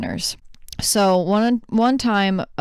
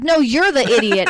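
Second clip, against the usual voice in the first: higher, louder comeback; imitated sharp retort; 5 semitones higher, 5 dB louder than usual. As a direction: throw the insult back, acting it out. Mom's retort in retelling.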